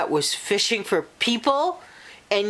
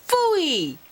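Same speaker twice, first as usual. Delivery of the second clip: put-on high scoffing little girl; 9 semitones higher than usual. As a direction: scoff like a cocky kid. Cheryl scoffs in story.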